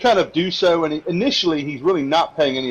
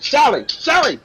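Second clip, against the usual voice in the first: mildly raised, high call for staff; summoning a barmaid; 10 semitones higher than usual. Acted. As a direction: innkeeper calling across a busy room. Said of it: "starts clicking his fingers".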